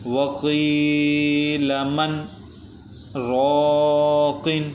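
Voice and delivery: slow, drawn-out melodic tajweed recitation; flowing Quran recitation. Shown as a demonstration. Teacher recites the verse for the students, slow melodic chant. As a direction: chant the verse slowly and evenly. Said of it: "پہلے اس کو رواں پڑھتے ہیں".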